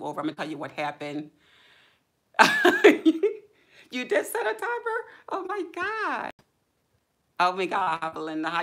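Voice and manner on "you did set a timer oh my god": high, loud laughing disbelief; laughing surprise. Real, not acted. Streamer laughing in surprise at a viewer's comment. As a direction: crack up and squeal at the chat.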